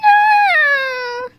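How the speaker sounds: crying shout. Pretend. Child shouts at robber.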